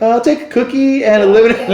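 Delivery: very high, loud, silly voice; disguised voice. Retold or imitated. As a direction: hide your identity behind a squeaky order. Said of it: "trying to hide it".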